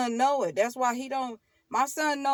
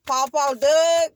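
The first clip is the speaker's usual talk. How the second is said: emphatic name; 5 semitones higher, 11 dB louder, slower than usual. Real, not acted. Naming the grandpa emphatically.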